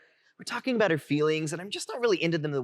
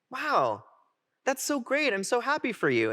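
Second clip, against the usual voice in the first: higher-pitched mild gush; lightly acted happy surprise; retold supportive reply; 9 semitones higher than usual. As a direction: react with gentle delight for a friend. Retold supportive reply to friend coming out.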